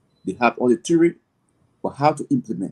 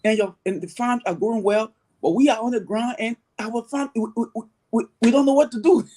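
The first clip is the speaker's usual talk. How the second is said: higher-pitched, fretful mock plea; imitating worried farmers; 8 semitones higher than usual. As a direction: play the puzzled farmers back home calling for help. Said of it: "they will call me and say".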